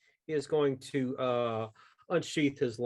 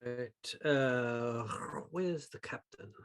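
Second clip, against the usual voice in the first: ordinary talk, nothing marked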